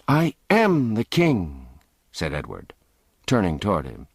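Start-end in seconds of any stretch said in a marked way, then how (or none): 0.1-1.7 higher, slow, proud insistence; indignant boy king. Acted. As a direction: insist on your crown. Boy insists on his rank.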